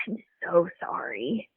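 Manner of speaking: hushed joking apology to her sister; mock apology. Walking past the trophies, joking to sister: mock sorry. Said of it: "I jokingly will look at my sister".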